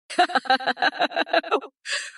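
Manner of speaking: bursting into a cackle. Acted. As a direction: cackle at the idea. AI Kamala laughs at claim.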